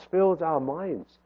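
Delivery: ordinary delivery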